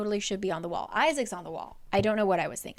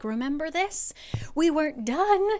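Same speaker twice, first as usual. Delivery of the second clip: high emotional plea; 7 semitones higher than usual. Retold or imitated. Feyre telling Rhysand their love story, raised high. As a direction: lift it, pleading.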